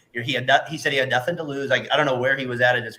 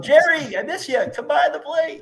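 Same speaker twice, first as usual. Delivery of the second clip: much higher, laughing plea; mock pleading call; 5 dB louder than usual. Host pretending the catcher calls the umpire back, high laughing whine. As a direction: whine it up high like a lovesick fan.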